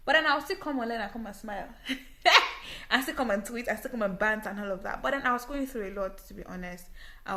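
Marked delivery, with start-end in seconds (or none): 1.7-2.8 short laugh. Real, not acted. Laughs off hard times.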